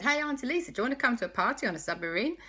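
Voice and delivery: high, fast excited question; children begging auntie to come along; eager asking. Acted. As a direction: rush the invitation. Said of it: "So Alexander and Christopher said".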